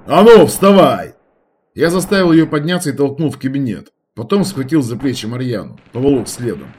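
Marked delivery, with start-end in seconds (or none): 0.0-1.1 loud, slow order; shouting. A narrator's reading. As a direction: bark the order. Forcing girl to stand.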